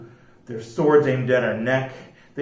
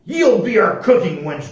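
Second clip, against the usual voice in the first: raised, higher pirate captain voice; louder storybook pirate command; cried-out order; 5 semitones higher, 5 dB louder than usual. A narrator's reading. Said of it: "Jack cried".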